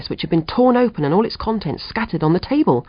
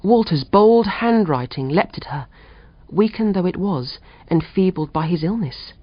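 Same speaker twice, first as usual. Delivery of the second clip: ordinary delivery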